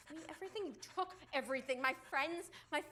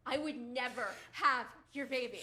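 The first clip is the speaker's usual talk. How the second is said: louder taunts; defiant shouting; 4 semitones higher, 5 dB louder than usual. Acted. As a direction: provoke him. She taunts him.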